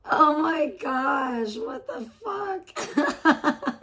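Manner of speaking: high, drawn-out cry of disbelief; shocked disbelief. Real, not acted. Streamer reacts to a chat message in drawn-out disbelief. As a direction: stretch it out in stunned shock.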